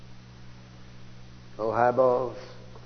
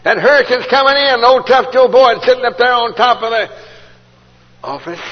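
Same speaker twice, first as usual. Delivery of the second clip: booming preaching; 10 semitones higher, 13 dB louder than usual. Real, not acted. Preacher building the storm scene.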